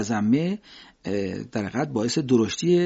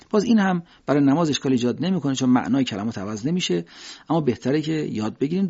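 ordinary talk

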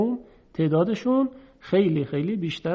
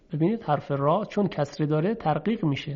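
ordinary delivery

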